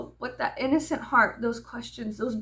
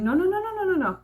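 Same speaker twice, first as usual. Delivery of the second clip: high, loud mocking no's; a hammed-up run of no's, much higher; mock scolding; 9 semitones higher, 3 dB louder, faster than usual. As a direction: mimic a parent shutting a kid down. Mocking silencing parents.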